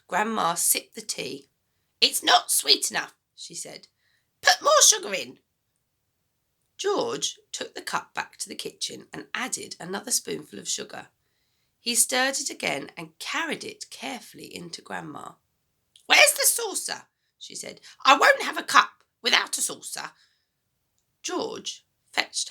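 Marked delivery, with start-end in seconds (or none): 2.0-5.4 put-on high, loud, bossy old woman; a mean grandma giving orders, high and loud; grumpy grandma voice. Grandma complains. 16.1-20.2 put-on high, loud, bossy old woman; a mean grandma giving orders, high and loud; grumpy grandma voice. Grandma demands.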